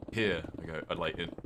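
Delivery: ordinary talk, nothing marked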